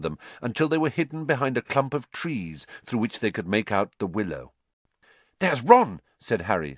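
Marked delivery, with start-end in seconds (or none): 5.4-6.0 high, loud, sudden spotting; sudden exclamation. A narrator's reading. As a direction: blurt it out on spotting a friend.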